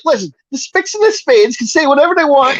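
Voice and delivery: high Archie Bunker rant. Retold or imitated. Archie lecturing the family, high blustering. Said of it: "He's like".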